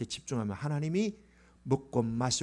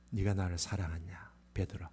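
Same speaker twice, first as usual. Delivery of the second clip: Jesus asking gently; 8 semitones lower, 8 dB quieter than usual. Acted. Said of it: "예수님이 딱 한 가지 물었어요".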